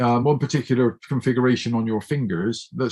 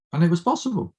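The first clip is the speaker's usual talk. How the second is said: ordinary talk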